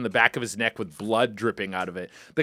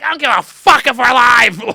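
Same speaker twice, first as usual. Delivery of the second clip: very loud, high furious retort; screaming angry mom; 9 semitones higher, 10 dB louder than usual. Retold or imitated. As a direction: imitate a mother yelling back, outraged. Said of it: "I can hear her voice in my head screaming".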